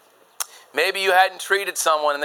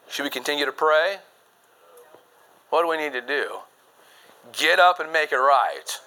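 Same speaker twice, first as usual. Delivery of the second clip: usual speaking voice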